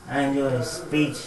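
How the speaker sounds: ordinary talk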